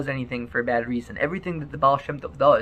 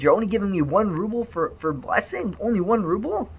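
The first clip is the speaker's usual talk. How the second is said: mock indignant Baal Shem Tov; 8 semitones higher than usual. Rabbi voiced in retold folk tale.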